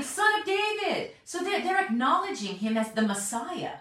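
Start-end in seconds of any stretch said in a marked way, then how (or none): 0.0-1.1 much higher called-out name; shouting out. Retold or imitated. Blind men shouting to Jesus in the crowd, calling out. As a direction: call across a crowd to be heard. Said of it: "They're shouting".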